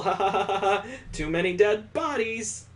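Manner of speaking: usual speaking voice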